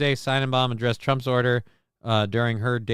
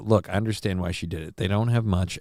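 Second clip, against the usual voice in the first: normal talking voice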